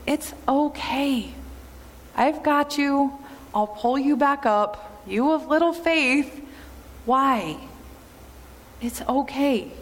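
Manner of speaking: tender reassurance. Retold or imitated. Jesus comforting Peter after pulling him up, tender. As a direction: soothe a frightened friend.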